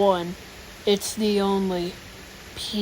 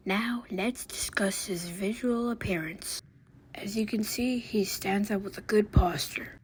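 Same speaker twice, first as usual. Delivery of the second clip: documentary whisper; 6 dB quieter than usual. Acted. Nature documentary narrator whispering.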